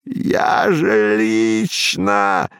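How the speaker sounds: slow, strained whispered cry; whistling whisper-shout. A narrator's reading. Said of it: "свистящим шёпотом прокричал он".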